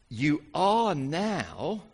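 slow emphasis. Acted. Preacher slows for emphasis.

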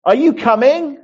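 high, loud vicar calling down corridors. Acted. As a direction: sing out a call through a huge house. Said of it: "his voice resonates down the corridor".

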